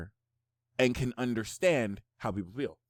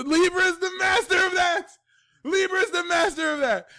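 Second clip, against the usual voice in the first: much higher, louder hyped yell; excited shout; 6 dB louder than usual. Real, not acted. Reader hollering that Libra masters backhanded praise. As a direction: holler it with delight.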